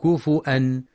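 كفؤا said kufu'an, with a glottal stop; ku-fu-'an for كفؤا, no w; hamza with damma. Shown as a demonstration. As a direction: break before the last syllable of كفؤا. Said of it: "بالهمزة مع ضم الفاء".

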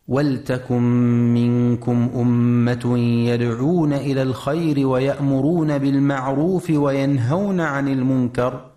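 measured tajweed chant of the verse; Quran recitation. Acted. Radio tajweed teacher reciting the verse for listeners, measured chant.

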